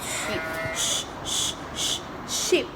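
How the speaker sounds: soft unvoiced sh-sheep; whispered sheep. Shown as a demonstration. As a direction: whisper the sh sound. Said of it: "modelling sh sound".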